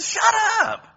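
very loud, high angry snap; harsh rebuke. Retold or imitated. Crowd fed up with the beggar's shouting. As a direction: bark him into silence. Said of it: "And so they said".

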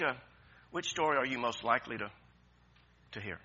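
ordinary talk, nothing marked

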